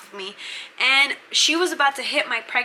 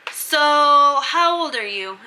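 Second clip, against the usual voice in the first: put-on louder, slow and higher "So how old are you?"; stern warden question; 4 semitones higher, 6 dB louder, much slower than usual.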